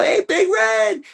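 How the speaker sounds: very high, slow, stretched shout; big call out. Retold or imitated. Surprising Big Red from the tall grass, a very high drawn-out yell. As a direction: pop up and yell his name, drawn out.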